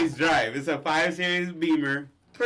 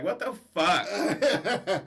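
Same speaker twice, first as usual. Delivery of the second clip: drawn-out, higher incredulous question; mock disbelief; 5 semitones higher, much slower than usual. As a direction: stretch it out in comic shock, half laughing. Retold shocked reaction, with laughter.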